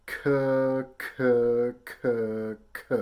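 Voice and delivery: loud, separate "K" syllables said as "kuh"; K-tongue syllables. Shown as a demonstration. Teacher showing forceful release with a K sound, "kuh" for each "K". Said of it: "It's with a K sound".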